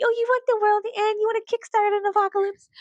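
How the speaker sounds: high, mocking baby voice. Mocking apocalypse fans, high taunting baby voice. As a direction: taunt them like a squeaky infant. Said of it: "You sound like a fucking baby".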